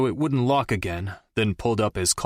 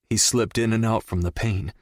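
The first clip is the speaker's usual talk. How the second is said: ordinary delivery, nothing marked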